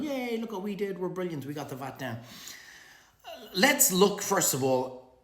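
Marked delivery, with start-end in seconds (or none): 0.0-2.2 high, soft gloating voice; a fading mock cheer of smug restaurateurs; mock smug celebration. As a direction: crow over the win. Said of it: "Restaurant Association of Ireland going".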